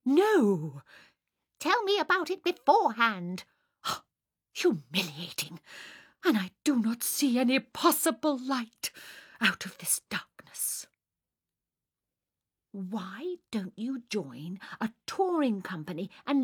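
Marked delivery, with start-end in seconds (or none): 0.0-10.9 loud, high outburst sinking into gloom; tragic Fräulein. Acted. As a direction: lament like a melodramatic stage heroine. Sonia laments dramatically.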